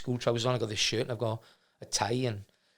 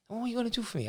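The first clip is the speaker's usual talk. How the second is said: high-pitched, softer imitation of Leicester speech; broad Leicester accent; 10 semitones higher, 5 dB quieter, much faster than usual. Club owner challenges a teenager asking for work, imitated Leicester accent. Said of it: "broad Leicester accent".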